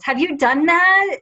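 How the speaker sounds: normal talking voice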